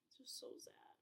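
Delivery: soft whisper, on the edge of tears; whispered sadness. Real, not acted. Telling viewers the book's sad, whispering. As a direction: breathe it out like you might cry. Sad about Will's tattoo.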